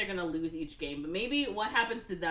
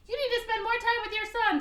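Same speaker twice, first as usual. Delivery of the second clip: much higher, louder nagging wife voice; 6 dB louder than usual. Retold or imitated. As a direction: mimic a wife scolding her husband. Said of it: "That's exactly what he sounds like".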